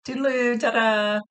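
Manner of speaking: bouncy ta-da exit; playful farewell. Retold or imitated. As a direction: sign off with a cheeky flourish. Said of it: "He turns around and goes".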